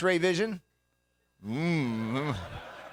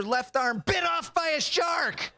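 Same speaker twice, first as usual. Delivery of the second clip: raised, high-pitched indignant shout; mock outrage; 11 semitones higher, slower than usual. Real, not acted. Comic aghast at his date's shark movie, high indignant shout.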